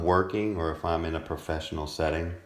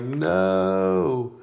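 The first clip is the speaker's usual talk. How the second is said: long drawn-out, louder high no; imitating a reaction; 6 semitones higher, 4 dB louder than usual. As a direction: stretch it out in mock protest. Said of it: "You're like".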